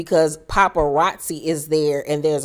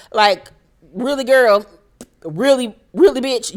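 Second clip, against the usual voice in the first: incredulous sass; 5 semitones higher, 4 dB louder than usual. Real, not acted. Mocking Diana's claim.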